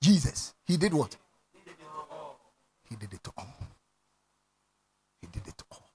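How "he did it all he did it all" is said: very soft, slow, almost whispered; hushed whisper. Acted. Pastor closing the point softly to the church, whispered. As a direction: drop to a breath of reverence.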